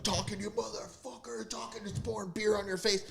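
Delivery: high, swaggering wrestler trash talk; hammed-up wrestling promo; The Rock impression. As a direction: taunt the opponent like a pro wrestler. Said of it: "The Rock bounces up and it's like".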